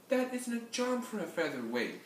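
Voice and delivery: high-pitched smug cat voice; smug purr. Acted. Tabby on the fence to the rooster, smug high voice. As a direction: purr it with superior ease. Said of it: "spoke up smugly".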